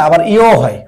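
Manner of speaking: ordinary delivery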